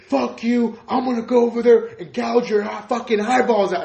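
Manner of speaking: high, loud furious mock yell; yelling angry caller. Retelling a patient's brother's phone threat, imitated yelling. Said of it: "he started yelling".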